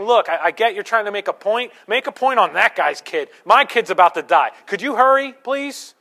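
loud, urgent begging from a frantic father; desperate plea. Retold or imitated. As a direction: plead loudly, hurry him. Father begs Jesus for dying son.

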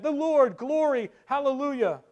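high, loud cries of praise; crowd calling out. Retold or imitated. Brethren answering who made the difference, calling out. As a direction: shout praise as a roused congregation. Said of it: "they said".

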